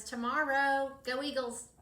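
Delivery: rousing cheer. Real, not acted. Art teacher cheering on returning middle schoolers, rousing. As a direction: send them off with a loud cheer.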